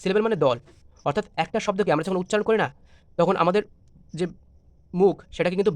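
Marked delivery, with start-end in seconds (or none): none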